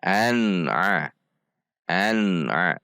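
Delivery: an-'a joined, N clear; clear izhar. Shown as a demonstration. Teacher models practice word, an-'a clear and joined. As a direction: join an to throaty a without bouncing or droning. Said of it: "Namun dibaca tidak terpisah".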